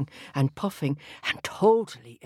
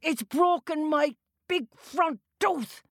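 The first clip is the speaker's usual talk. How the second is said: high, wailing giant complaint; outraged about his broken tooth; giant in pain; 12 semitones higher than usual. A narrator's reading.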